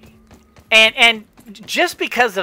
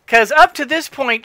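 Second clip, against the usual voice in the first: ordinary delivery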